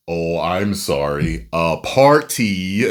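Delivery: slow, drawn-out imitated apology; correcting himself. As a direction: enunciate "party" slowly and fully. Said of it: "And he was like".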